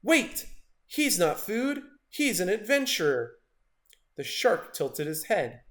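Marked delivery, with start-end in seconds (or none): 0.0-3.4 high urgent shout, higher on the first word. A narrator's reading. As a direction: cry out to stop the shark. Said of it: "But Wiggles shouted".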